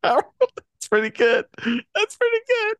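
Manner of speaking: struggling to talk through a laugh. Real, not acted. Giving in to the Rock pun, laughing.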